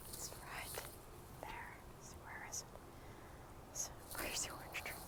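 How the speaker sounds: very soft, almost whispered muttering; real hushed self-talk; quiet aside. Real, not acted. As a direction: mumble to yourself while searching. Aside while finding tree on screen.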